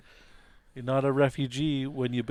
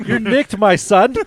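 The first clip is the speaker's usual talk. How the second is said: loud, high, through laughter; laughing shout; 9 semitones higher, 18 dB louder than usual. Acted. Player shouting at the mayor, laughing loudly. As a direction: yell it while cracking up.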